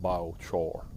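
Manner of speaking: Southern "biochar", BY-oh-chor with a drawn vowel; Southern accent. Shown as a demonstration. Showing viewers the Southern way of saying it. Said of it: "down south".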